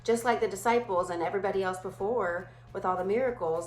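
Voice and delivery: ordinary talk, nothing marked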